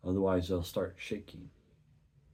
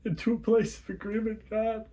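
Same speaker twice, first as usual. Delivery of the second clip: much higher, louder, sobbing through words; tearful crying prayer; 10 dB louder than usual. Acted. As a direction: plead with God through tears.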